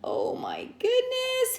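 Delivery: usual speaking voice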